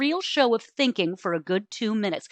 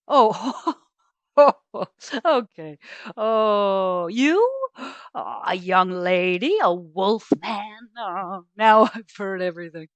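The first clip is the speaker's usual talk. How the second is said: condescending chuckle through the words; laughing dismissively. Acted. Doctor to Ava, mocking her question, laughing. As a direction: laugh her off, slow and patronizing.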